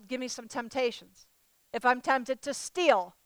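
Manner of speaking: ordinary talk, nothing marked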